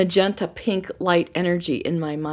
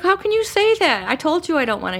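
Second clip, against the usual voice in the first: indignant protest; 9 semitones higher, 3 dB louder than usual. Retold or imitated. Wife protests to husband.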